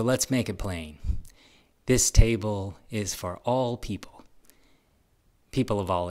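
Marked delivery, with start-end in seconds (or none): none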